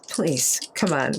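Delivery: normal talking voice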